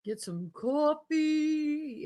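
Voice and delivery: slow, loud call across the room. Real, not acted. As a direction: stretch it out, calling to someone away from the mic. Calling out to someone off mic.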